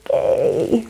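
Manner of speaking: soft, drawn-out, rising "okay"; wary backing off. Acted. Spouse answers an angry outburst, soft and wary. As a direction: back away carefully from an outburst. Listener backs off after outburst.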